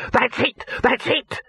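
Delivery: high-pitched elated exclamation; excited yell. A narrator's reading. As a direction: yelp it out, thrilled. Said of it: "he yelled".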